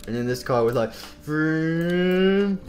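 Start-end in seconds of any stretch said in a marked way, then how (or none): 1.2-2.6 rising car-motor noise; imitated engine vroom. This car driving in, imitated engine noise. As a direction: rev a long vroom, climbing in pitch.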